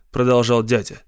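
usual speaking voice

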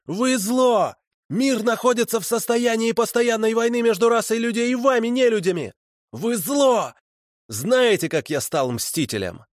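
sustained high-pitched tirade; accusing wail. A narrator's reading. Fanatic tells captors they are evil, wailing accusation. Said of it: "обличающе завопил он".

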